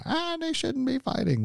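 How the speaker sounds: high, thin whiny complaint; mock exasperated parent. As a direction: whine like a fed-up parent. Parent yelling at fighting kids.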